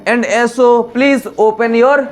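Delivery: slow classroom instruction with rising end; patient slow teacher; slow teacher command. Shown as a demonstration. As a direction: draw each word out for learners. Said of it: "मतलब कि".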